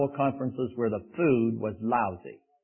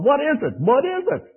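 much higher, rising bewildered ask; puzzled wonder; 11 semitones higher, 3 dB louder than usual. Acted. Israelites staring at the manna, high and puzzled. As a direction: sound baffled by the strange food. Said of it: "the Hebrew word simply means".

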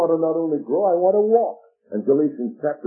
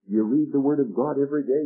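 usual speaking voice

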